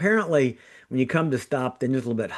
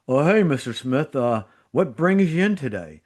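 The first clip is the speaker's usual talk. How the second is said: goofy New York intern greeting, a bit higher; New York accent; 4 semitones higher than usual. Retold or imitated. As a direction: lay on a New York accent, casual and cheerful. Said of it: "he says it with a New York accent".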